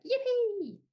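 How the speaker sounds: high, loud joyful squeal; excited cry. Shown as a demonstration. Storyteller inviting kids to cheer, high squeal. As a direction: squeal it for the children to copy. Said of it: "You can cry yippee too".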